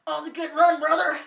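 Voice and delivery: loud mock wrestler praise; Hulk Hogan. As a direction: roar it like a pumped-up TV host. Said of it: "he's like".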